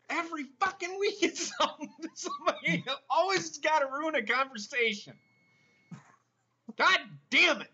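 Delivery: high, loud rant edged with laughter; loud comic outrage at a ruined conversation; exasperated rant. Real, not acted. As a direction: rant loudly, half laughing. Host rants about ruined conversation.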